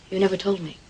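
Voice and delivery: usual speaking voice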